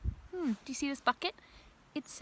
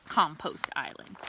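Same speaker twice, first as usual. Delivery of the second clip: plain reading voice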